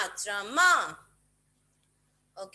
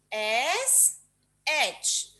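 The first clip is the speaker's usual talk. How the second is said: ordinary delivery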